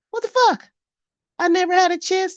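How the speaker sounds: outraged. Real, not acted. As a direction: explode in disbelief. Outrage at submission expectation.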